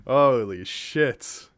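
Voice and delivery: ordinary talk, nothing marked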